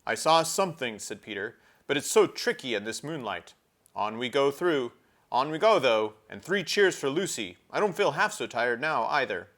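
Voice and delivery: rally the group; cheerful Peter. Acted.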